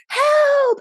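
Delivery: very high, sustained cry for help; acted yell. Woman holding the door yells for help, acted holler. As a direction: re-enact hollering for rescue. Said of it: "I yelled".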